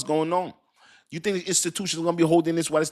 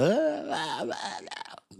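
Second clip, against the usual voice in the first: mock panic babble; 8 semitones higher, 6 dB quieter, much slower than usual. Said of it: "That's why I don't go".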